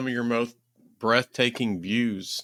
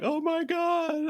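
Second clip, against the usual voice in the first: high, slow, wailing mock cry; mock sob; 4 dB quieter, slower than usual. Imagining crying at the last Texas peak, high slow mock wail. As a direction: pretend to break down in tears.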